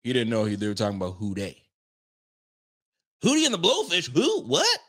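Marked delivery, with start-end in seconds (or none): 3.2-4.8 mock disbelief. Mock bafflement over Hootie.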